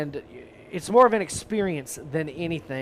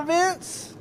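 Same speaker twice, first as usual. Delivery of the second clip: playful shout; 13 semitones higher than usual. Real, not acted. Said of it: "Vince wants you to roar like a tiger".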